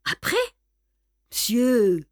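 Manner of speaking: mildly heated challenge; irritated retort. A narrator's reading. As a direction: answer back with some irritation. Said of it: "deux voix irritées s'élevèrent".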